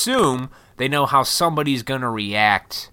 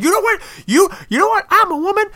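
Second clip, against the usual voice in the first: high, loud falsetto parody of an indignant woman; hammed-up shrill mock protester; mock offended woman; 5 dB louder than usual. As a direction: squeak it out like an outraged caricature. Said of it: "They're fired up, like".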